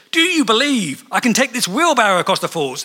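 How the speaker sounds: high, loud showman call to the crowd; rally the crowd like a showman. Retold or imitated. Performer calls out to crowds at Niagara Falls, loud and high. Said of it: "And he says".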